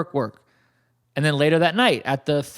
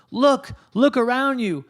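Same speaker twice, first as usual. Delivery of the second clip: in pain; 8 semitones higher than usual. Retold or imitated. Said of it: "she gets shot, and she goes".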